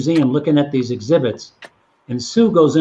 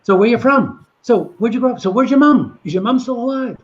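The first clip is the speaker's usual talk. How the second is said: pepper her with excited questions; eager questions; 8 semitones higher than usual. Retold or imitated. [name] quizzing the wife about Liverpool, excited and higher.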